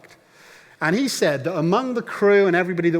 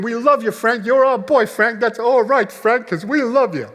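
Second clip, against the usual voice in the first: high, loud cheering calls; crowd shouting support; 7 semitones higher, 5 dB louder than usual. Retold or imitated. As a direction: imitate fans yelling encouragement to the stage. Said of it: "other people started to stand to their feet".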